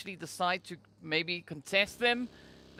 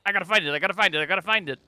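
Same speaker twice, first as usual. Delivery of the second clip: restless players; 5 dB louder, much faster than usual. Acted. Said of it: "they hear cues and then they're like".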